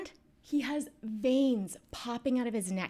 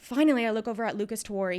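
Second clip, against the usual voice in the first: normal talking voice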